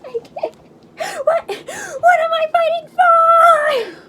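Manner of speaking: high, slow wailing sob; hammed-up crying question; mock crying.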